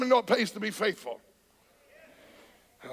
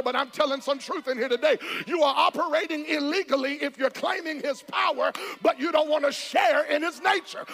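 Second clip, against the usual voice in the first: firmer and faster; lightly pushed preaching; 4 semitones higher than usual. Acted. Pastor warning false claimants, pushing harder. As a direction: press the truth on them.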